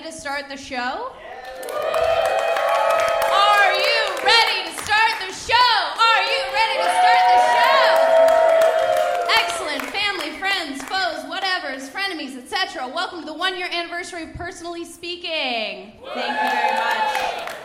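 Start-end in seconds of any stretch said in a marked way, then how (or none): 3.3-7.8 hype shout. Real, not acted. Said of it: "guys, are you ready". 9.4-17.5 excited. Real, not acted. Live host hyping crowd.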